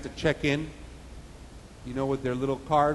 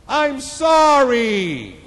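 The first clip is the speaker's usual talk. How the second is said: loud, high, long apology; screamed out; 10 semitones higher, 9 dB louder, much slower than usual. Acted. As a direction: holler it out, stretching the words. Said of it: "He screamed out".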